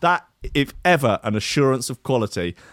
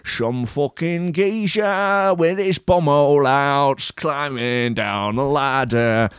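put-on slow, drawn-out Manchester accent, pitched up; 4 semitones higher, slower than usual. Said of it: "I'm gonna do a sort of ... [name], Manchester accent".

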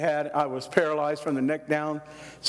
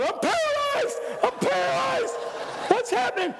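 panicked shout. Retold or imitated. Boy wakes to the crash, panicked shouting that he's paralyzed. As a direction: cry out in terror that you can't move. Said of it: "And I thought".

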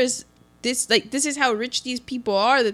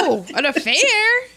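high, hammed-up incredulous panic; mock freak-out; 9 semitones higher, 4 dB louder than usual. Benjamin asked about an affair by the clerk, mock freaking out. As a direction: imitate a flustered squawk of denial. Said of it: "Benjamin completely freaks out".

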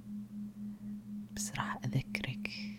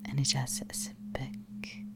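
ordinary talk, nothing marked

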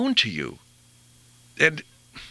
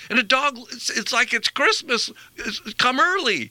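much higher, lifting with delight; excited; 9 semitones higher than usual. Real, not acted. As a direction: gush like the dog's Christmas came early. Describes the dog's joy at going out.

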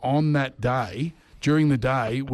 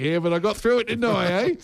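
high, cocky comeback; cheeky; 8 semitones higher than usual. Retold or imitated. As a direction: throw it back smugly. Cheeky retort to boss.

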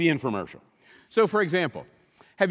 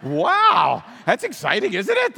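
mock excitement; 3 semitones higher, 6 dB louder than usual. Mocking a celebrity weight-loss ad.

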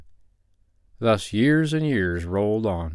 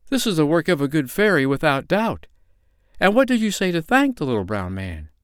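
higher, wondering mother; mildly astonished, pitched up; amazed; 7 semitones higher than usual. A narrator's reading. Mother marvels at her cured child, mildly amazed. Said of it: "cried her mother in amazement".

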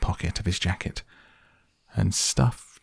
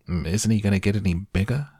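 ordinary delivery, nothing marked

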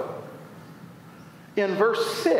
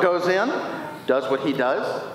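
ordinary delivery

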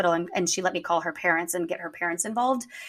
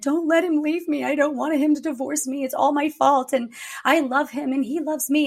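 imitating a crying girl; 6 semitones higher than usual. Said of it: "she was crying and she's like".

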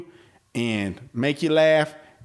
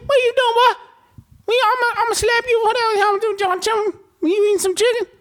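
much higher, louder squeaky alien; country alien voice; 6 dB louder, faster than usual. Pretend. Showing how aliens probably talk, a high loud squeaky voice. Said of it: "They probably talk like this".

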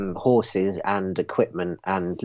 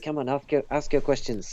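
put-on French accent; 3 semitones higher, 3 dB quieter, faster than usual. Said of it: "your French accent".

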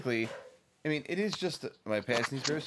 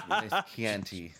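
laughing; 9 semitones higher, 3 dB louder, much slower than usual. Real, not acted. Laughing at echo.

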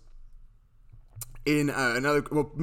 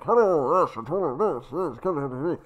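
lightly camp muffled Bane voice; a hint of the campy early Bane; mock camp villain voice; original camp Bane. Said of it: "it's more kind of like".

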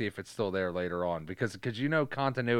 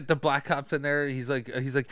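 normal talking voice

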